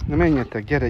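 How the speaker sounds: ordinary delivery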